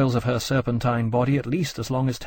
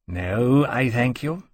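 coolly offended refusal; much slower than usual. A narrator's reading. Dragon turns down his rival's invitation, very cool. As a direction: decline stiffly and coolly. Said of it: "said very coolly".

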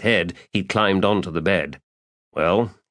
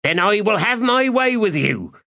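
villain threat; 13 semitones higher than usual. Acted. Thief playing villain.

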